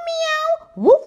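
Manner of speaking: high loud meow sliding into a woof; cat meow then dog bark. Acted. Animals charge the robbers.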